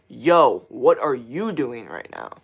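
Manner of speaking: punchy yo, then slow, deliberate question; talking at viewers. Shown as a demonstration. Streamer showing viewers his engaging style, emphatic. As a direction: confront the listener word by word. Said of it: "It's like".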